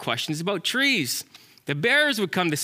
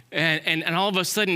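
ordinary delivery

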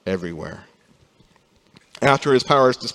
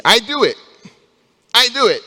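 put-on much higher, louder little-child voice; toddler insisting; 12 semitones higher, 7 dB louder than usual. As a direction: play the stubborn two-year-old demanding. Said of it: "his thing he likes to say".

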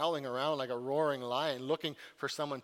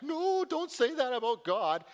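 high, loud shocked protest; alarmed friends; 9 semitones higher, 5 dB louder than usual. Retold or imitated. Job's friends scolding him for speaking against God, high alarmed protest. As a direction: hurry to hush him up. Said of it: "all of Job's friends are like".

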